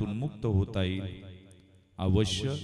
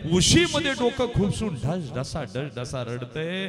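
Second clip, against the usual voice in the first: anguished sobbing cry; 7 semitones higher, 7 dB louder than usual. Acted. Father hiding in the inner room sobbing, loud wail. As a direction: cry out the grief, then let it sink.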